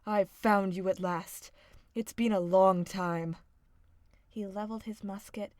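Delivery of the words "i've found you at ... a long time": much louder, lower shout; triumphant hunter. A narrator's reading. Hunter catching the wolf, loud and low. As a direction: bellow at the wolf.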